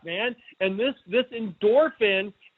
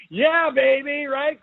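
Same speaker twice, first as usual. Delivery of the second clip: higher, louder gleeful cheer; excited whoop; 4 semitones higher, 3 dB louder, slower than usual. Real, not acted. Cheering his book and movie pun to viewers, excited. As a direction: celebrate your own pun. Pleased with his own joke.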